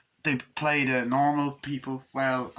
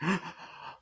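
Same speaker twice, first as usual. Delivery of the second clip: sharp gasp; 8 semitones higher, 7 dB quieter than usual. Acted. Sinister crying double.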